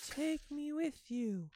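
put-on high, slow eerie child's voice; a tiny ghostly child pleading; creepy child voice. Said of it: "as if a child were speaking".

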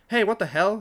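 loud, high indignant yell; startled man yelling. Acted. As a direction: shout at whoever hit you. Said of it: "he yelled".

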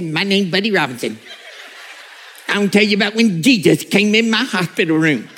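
lightly voiced old country preacher; a hint of the Buddy Robinson tape voice; mild high whiny old man. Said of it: "high-pitched and whiny and a little bit like Elmer Fudd".

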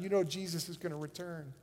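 ordinary talk

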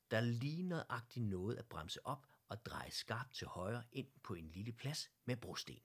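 ordinary delivery

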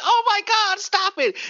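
mock the panicked hippie girls; shrieking girls.

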